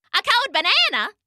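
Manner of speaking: high, incredulous caller; another phone voice. A narrator's reading. Another voice on the phone reacting, high incredulous query. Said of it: "said another voice on the phone".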